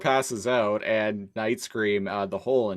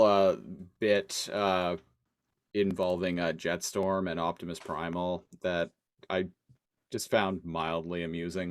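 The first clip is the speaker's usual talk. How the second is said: ordinary talk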